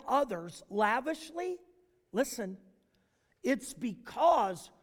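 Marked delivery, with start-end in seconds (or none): none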